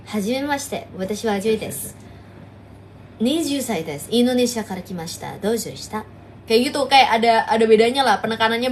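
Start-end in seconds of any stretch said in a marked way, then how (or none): 0.1-6.0 low, deliberate Japanese self-introduction; Japanese accent. Shown as a demonstration. Streamer demos to chat how Japanese sounds, a low Japanese accent. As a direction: introduce yourself like a Japanese speaker. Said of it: "Kalau misalnya Jepang itu".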